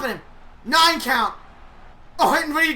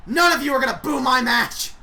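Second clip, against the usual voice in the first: loud, fast, indignant shouting; mock Randy Orton rant; 3 dB louder than usual. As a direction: rant at the booing crowd. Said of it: "Randy says".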